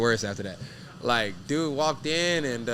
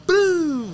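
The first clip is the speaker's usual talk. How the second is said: loud, high imitated crash; crash boom; 7 dB louder than usual. Telling the hosts how the fleeing car hit the trailer, loud boom. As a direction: shout the impact sound out. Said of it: "crash sound".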